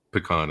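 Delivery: "pecan" said puh-KAHN; reduced first vowel in "pecan", then KAHN; puh-KAHN pronunciation. Real, not acted. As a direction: stress the broad AH in "pecan". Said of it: "Yes, I say".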